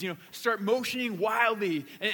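ordinary talk, nothing marked

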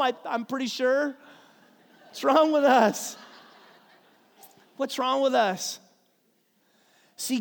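ordinary talk

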